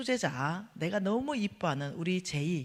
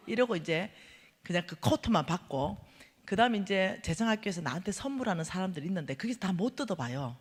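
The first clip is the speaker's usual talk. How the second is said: ordinary talk, nothing marked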